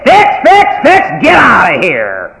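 loud impatient dad snapping; father brushing off his kid, loud and impatient; irritated shout. Retold or imitated. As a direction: bark it out, fed up.

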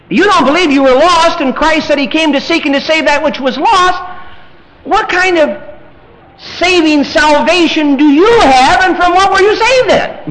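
high, loud pulpit challenge; loud, impassioned sermon questioning; forceful preaching. Real, not acted.

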